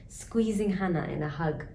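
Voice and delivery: plain reading voice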